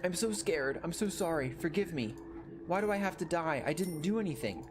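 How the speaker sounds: put-on high, frightened child pleading; terrified begging. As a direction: beg for your life. Child begging monster for life.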